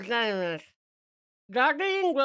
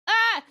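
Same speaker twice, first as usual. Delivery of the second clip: high loud hiccup squeal; sudden squealing hiccup gasp; 10 semitones higher, 7 dB louder than usual. Real, not acted. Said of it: "then I'm hiccuping".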